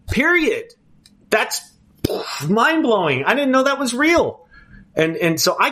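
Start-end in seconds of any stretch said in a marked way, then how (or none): 0.1-4.5 high, excited exclamation. Real, not acted. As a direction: marvel at it, voice lifting. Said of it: "excited about helping".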